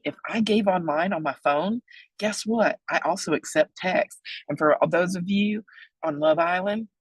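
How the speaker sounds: usual speaking voice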